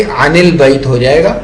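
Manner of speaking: usual speaking voice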